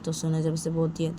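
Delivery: ordinary delivery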